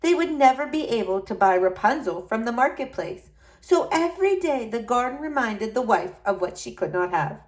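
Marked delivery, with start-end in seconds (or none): none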